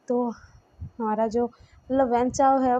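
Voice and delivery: normal talking voice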